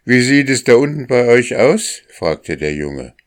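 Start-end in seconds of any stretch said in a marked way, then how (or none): 0.0-2.0 high, loud question to someone below; raised, carrying boy's voice; calling down. A narrator's reading. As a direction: call the question down from above. Boy calls down to rooster.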